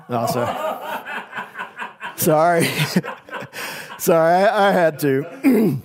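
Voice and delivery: breaking up into real laughter; giggly apology through laughs; laughing. Real, not acted. Preacher cracks up mid-sermon.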